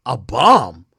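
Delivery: a loud, stunned "A bomb?"; stunned disbelief. Real, not acted. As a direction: repeat it like you can't believe it. Reacting to a car bomb.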